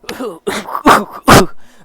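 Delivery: loud, exaggerated hacking cough; mock coughing fit. Reader acting out a character's cough, loud mock coughing. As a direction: fake a big coughing fit. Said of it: "assuming they just cough regularly".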